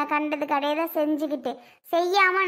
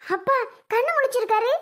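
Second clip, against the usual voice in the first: surprised joy; 5 semitones higher than usual. Acted. Daughter sees coma father wake.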